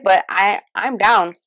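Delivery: normal talking voice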